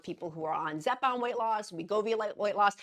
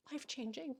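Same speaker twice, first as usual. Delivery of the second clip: tearful whisper; 7 semitones higher, 9 dB quieter than usual. Real, not acted. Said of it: "I need to stop crying".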